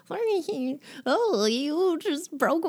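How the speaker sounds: high-pitched doddering wizard caricature; weaselly old wizard voice; nerdy old wizard. Retold or imitated. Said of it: "a nerdy old wizard voice".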